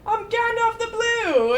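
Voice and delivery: a hammed-up wizard proclaiming his name; mock grand announcement. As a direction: announce Gandalf the Blue with pompous pride. Gandalf announced by the costumed man.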